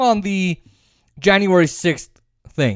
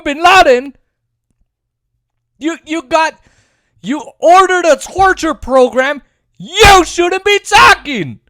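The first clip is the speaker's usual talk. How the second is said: angry rant; 8 semitones higher, 4 dB louder than usual. Real, not acted. Ranting at Bush over the wars, furious and loud. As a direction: tear into Bush, punching every you.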